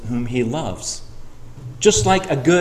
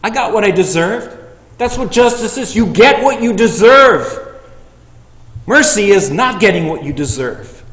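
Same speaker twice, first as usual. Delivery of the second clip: loud, high, driving sermon delivery; fiery preaching; 6 semitones higher, 8 dB louder than usual. Acted. Preacher urging the church to share the gospel, loud and fervent. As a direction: preach it hard to the congregation.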